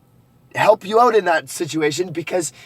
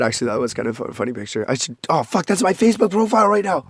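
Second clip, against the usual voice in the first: normal talking voice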